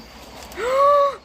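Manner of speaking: sharp loud delighted gasp; excited high gasp. Real, not acted. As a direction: gasp with joy. Excited reveal.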